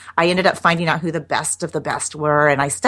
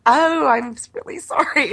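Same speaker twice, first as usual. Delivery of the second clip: high-pitched, playful apology; mock apology; 9 semitones higher than usual.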